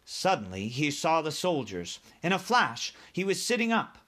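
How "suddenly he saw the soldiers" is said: ordinary delivery, nothing marked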